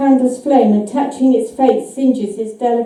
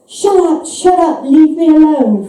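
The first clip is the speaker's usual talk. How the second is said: higher, louder cry to shut out the voice; frightened shout; 3 semitones higher, 3 dB louder than usual. A narrator's reading. Old Fred shouts back at his nagging wife's ghost, frantic. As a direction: yell at the ghost to leave him be. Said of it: "Fred shouts".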